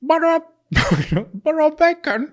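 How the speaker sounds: high, hammed-up British voice; British accent. Co-host attempting Victorian talk on request, high British accent. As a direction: squeak out a mock British accent, giggling. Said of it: "the one that can do the British".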